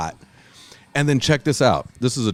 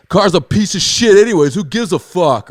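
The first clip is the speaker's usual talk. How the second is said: loud, high dismissive jeer; mocking frat-boy taunt, raised voice; heckling frat boys; 9 semitones higher, 6 dB louder than usual. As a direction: jeer like a bystander brushing it off.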